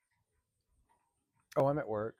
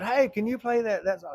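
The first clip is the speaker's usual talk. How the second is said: excited request; 9 semitones higher, 6 dB louder than usual. Retold or imitated. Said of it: "somebody said".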